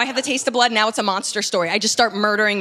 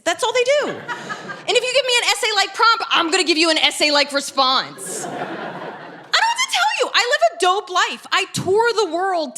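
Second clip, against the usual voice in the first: laughing rant; 10 semitones higher than usual. Acted. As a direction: whip yourself up into a giddy rant. Ranting about men's dating texts.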